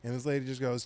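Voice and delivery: ordinary delivery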